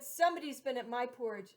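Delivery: middle-sized bear voice. Acted. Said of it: "in her middle-sized voice".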